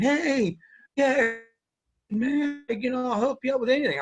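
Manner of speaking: high, sing-out friendly offer; overly nice sergeant. Acted. Grumpy first sergeant turned nice offers help, in high-pitched mock friendliness. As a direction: imitate someone being suspiciously helpful. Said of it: "he'd be nice".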